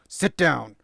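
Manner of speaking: sudden loud, firm command; stern parent voice. Shown as a demonstration. Parent showing the discipline voice, loud and stern. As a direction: snap out a loud order to a child. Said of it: "I can still turn on that voice".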